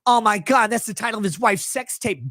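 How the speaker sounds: ordinary talk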